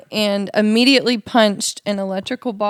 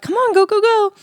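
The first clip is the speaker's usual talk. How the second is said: put-on high-pitched urging to kids; hurrying the kids; 12 semitones higher, 5 dB louder than usual. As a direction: mum hustling children along in a much higher voice. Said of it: "so I'm talking to them".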